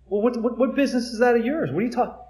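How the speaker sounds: indignant disciples. Retold or imitated. Disciples defensive, retold.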